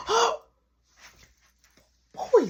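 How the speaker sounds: put-on high startled oh boy; a retold jolt of surprise. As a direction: caught off guard, voice jumps up. Caught sneaking, startled.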